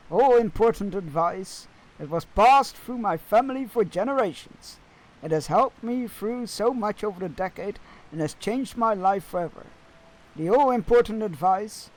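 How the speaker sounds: solemn mock wisdom from a quest character; pompous NPC voice. Reads NPC advice dialogue.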